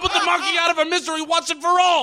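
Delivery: loud, fast, manic threat; furious rant. Acted. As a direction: spit it out in a rage. Boss raging at chimp.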